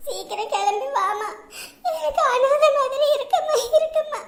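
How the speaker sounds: sobbing between broken phrases; forlorn, weeping appeal; crying plea. Acted. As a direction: beg your mother to come, in tears. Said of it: "பாவம் புள்ள அழுதுகிட்டு phone பண்றா".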